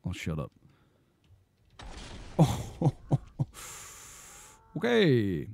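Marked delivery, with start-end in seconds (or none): 1.8-3.2 breathy laugh. Real, not acted. After his silly voices.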